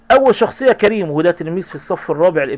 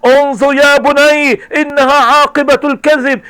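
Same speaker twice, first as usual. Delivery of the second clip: stern lifeguard; 8 semitones higher, 4 dB louder, slower than usual. Acted. Lifeguard lecturing rescued boy.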